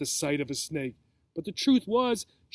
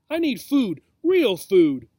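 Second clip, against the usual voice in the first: raised, punched demand; emphatic complaint; 4 semitones higher, 7 dB louder, slower than usual. A narrator's reading. Snake complains about being fed dead mice, emphatic. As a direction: hit FOOD and REAL hard.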